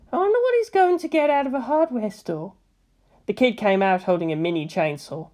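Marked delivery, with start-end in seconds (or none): 0.1-2.5 higher, curious woman; Misato's voice. A narrator's reading. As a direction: wonder aloud as Misato. Misato wondering aloud.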